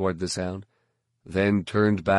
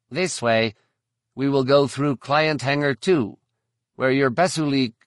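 higher and louder, a chirpy alien guide; high insectoid alien voice; 6 semitones higher, 4 dB louder than usual. A narrator's reading. Verpine guide answering the bounty hunter, high and eager. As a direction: pitch it up like an eager insect creature. Sess points the way.

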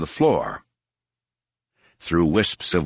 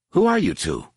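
suspicious, pointed question; sizing up two strangers; distrustful challenge; 8 semitones higher than usual. A narrator's reading.

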